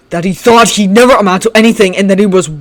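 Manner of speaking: loud screaming, voice raw; hoarse scream. Acted. Shinso screaming at Bakugo near tears. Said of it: "screamed, voice hoarse and sore".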